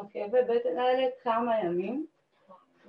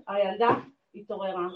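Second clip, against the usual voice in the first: ordinary talk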